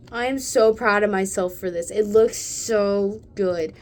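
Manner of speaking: loud proud gushing; excited. Real, not acted. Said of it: "I got a little excited".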